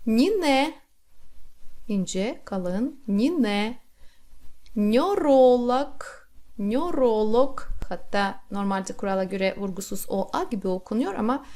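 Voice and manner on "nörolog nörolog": Nörolog said slowly, o kept unreduced; spelled pronunciation. Shown as a demonstration. Teacher reading Nörolog just as written. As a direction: read Nörolog slowly, each o clear. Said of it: "olduğu gibi okuyalım".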